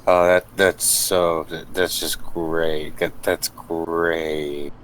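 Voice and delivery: slow, stretched-out awkward approval; weird small talk. Acted. Hannibal answering both women's pleasantries, weirdly drawn-out. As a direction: drag out each word, oddly stilted. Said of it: "notice him being weird".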